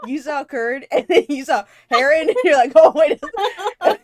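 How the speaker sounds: loud, high, laughing teasing; laughing loudly. Real, not acted. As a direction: tease her through big laughter. Teasing while laughing hard.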